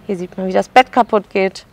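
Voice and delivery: usual speaking voice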